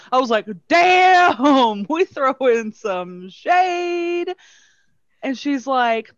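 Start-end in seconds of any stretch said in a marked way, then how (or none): 0.7-4.3 loud, high, drawn-out mock shock; mock astonishment. Hotel clerk reacting to caller's insult, loud mock astonishment. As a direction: exclaim it slowly, amused and scandalized.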